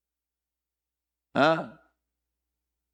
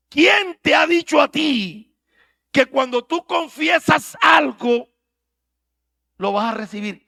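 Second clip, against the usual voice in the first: preaching shout; 8 semitones higher, 10 dB louder than usual. Real, not acted. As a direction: drive the question home. Preacher challenges loudly. (desafío gritado de predicador)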